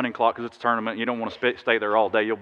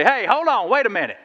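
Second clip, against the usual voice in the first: calling a timeout; 9 semitones higher than usual. Retold or imitated. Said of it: "Just say".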